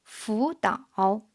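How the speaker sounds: "fu" rising as second tone before "dao"; third-tone sandhi. Shown as a demonstration. Teacher showing two third tones in a row, "fu" rising before "dao". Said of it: "should be pronounced in the second tone".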